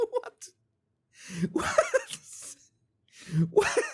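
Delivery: very high, laughing squeal of disbelief; incredulous laughter. Real, not acted. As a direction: squeal it through helpless laughter.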